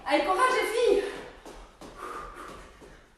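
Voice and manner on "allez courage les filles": louder rallying call; encouraging shout. Real, not acted. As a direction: push them on with energy. Coach encouraging during effort.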